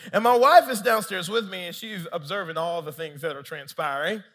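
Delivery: usual speaking voice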